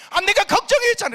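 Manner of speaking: high, loud cry of worry turned anger; very high shout. Retold or imitated. Husband shouting to his wife that he was worried. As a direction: shout it in a fit of relief and rage.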